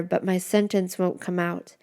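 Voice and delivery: usual speaking voice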